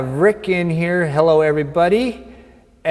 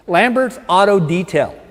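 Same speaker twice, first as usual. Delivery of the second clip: ordinary delivery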